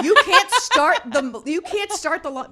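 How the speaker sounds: high, loud and slow, half laughing; blurting it out in disbelief at her son; exasperated yell. Retold or imitated. Mother yelling at her nine-year-old about the lawnmower, exasperated and laughing. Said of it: "I'm like".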